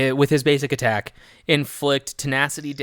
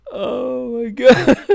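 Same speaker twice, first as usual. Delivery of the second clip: loud, high, drawn-out through laughter; laughing hard; 12 semitones higher, 9 dB louder, much slower than usual. Real, not acted. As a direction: gasp it out while cracking up. Helpless laughter at the bad impression.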